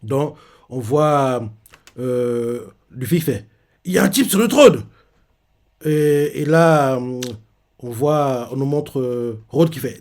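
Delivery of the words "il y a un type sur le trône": excited exclaim. Retold or imitated. Luffy exclaims.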